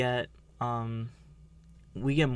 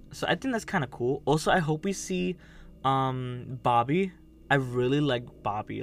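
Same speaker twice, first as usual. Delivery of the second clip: ordinary talk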